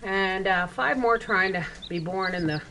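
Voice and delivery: normal talking voice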